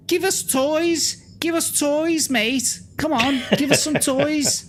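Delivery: put-on high, shrill-free squeaky old crones demanding toys; high squeaky demanding hags; squeaky old women begging. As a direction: beg like greedy childish old ladies.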